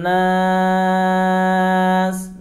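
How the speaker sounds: slow, drawn-out chanted recitation; Quran recitation. Acted. Teacher starts the surah with slow melodic tilawah. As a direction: recite with long, melodic held vowels.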